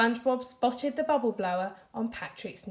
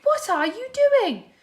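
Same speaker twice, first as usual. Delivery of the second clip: high, loud alarmed question; yelling; 13 semitones higher, 8 dB louder than usual. A narrator's reading. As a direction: shout it in disbelief. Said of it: "yelled Patrick".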